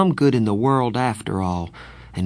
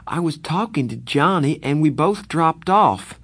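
pleading; 7 semitones higher, 4 dB louder than usual. Acted. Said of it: "I pleaded with Darry".

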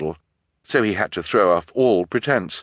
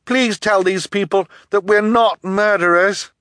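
put-on high, loud girl pleading; desperate, pitched-up protest; urgent plea; 13 semitones higher, 6 dB louder than usual. A narrator's reading. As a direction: beg a friend to clear you.

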